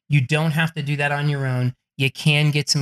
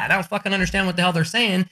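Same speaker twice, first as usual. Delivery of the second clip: normal talking voice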